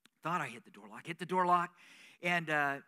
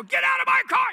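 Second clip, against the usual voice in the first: much higher, loud shouted rebuke; pretend panicked shout; 14 dB louder than usual. Pastor imagining scaring off the woman in his car, shouting. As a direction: yell it like you just got the fright of your life. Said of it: "What would have happened if I".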